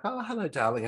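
high, cooing mock greeting; imitated greeting. Retelling the cat coming over, high imitated greeting. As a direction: put on a high sweet hello. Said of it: "she's like".

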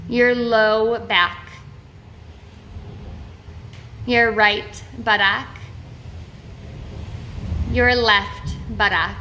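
slow, measured meditation voice with long pauses; name each body part slowly, leaving space after it; guided body-scan meditation. Real, not acted. Guide leading listeners through a body awareness meditation, slow and measured.

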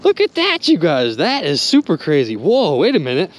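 ordinary talk

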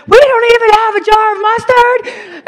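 very high, loud hysterical shriek; freaking out. Retold or imitated. Stressed woman melting down over missing mustard, hysterical shrieking. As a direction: act out a full meltdown at top pitch. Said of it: "she's, like, literally freaking out".

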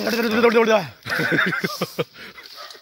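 bright burst of laughter; laughing. Real, not acted. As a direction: laugh out loud. Laughing at sour fruit.

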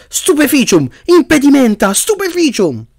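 high, urgent incantations; shouted spells. A narrator's reading. Harry casts repeatedly at the charging spider, crying out. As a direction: fire off spells in high cries.

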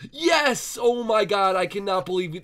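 high, loud burst of triumph; loud, thrilled victory cry; elated shout. Real, not acted. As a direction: explode with disbelieving joy. Hard fox breeding finally succeeds.

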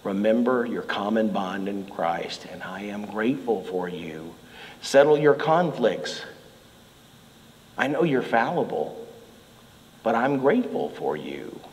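a hint of a gentle letter writer; gentle Paul. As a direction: speak gently like a caring pastor. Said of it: "he says".

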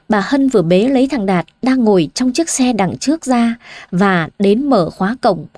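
ordinary delivery

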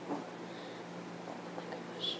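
hushed, voiceless amazement; awed whisper. Real, not acted. Vlogger marvelling at the freshly unwrapped squishy, awed whisper. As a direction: whisper it under your breath in awe.

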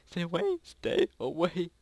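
high, panicky "stay away"; panicked plea. Real, not acted. Player begging a seeker to back off, panicked.

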